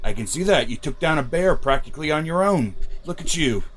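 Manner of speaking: ordinary delivery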